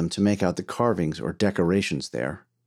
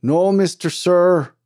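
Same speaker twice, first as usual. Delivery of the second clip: slow, high, drawn-out call; distant shouted reply; 8 semitones higher, 5 dB louder, slower than usual. A narrator's reading. Miner answering his boss through the tunnel, calling out. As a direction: holler a refusal back up the tunnel. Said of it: "came Yablonsky's voice echoing back through the tunnel".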